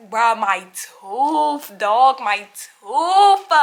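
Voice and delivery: drawn-out lament. Retold or imitated. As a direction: drag out "tooth" in exaggerated dismay. Retold dismay over broken tooth.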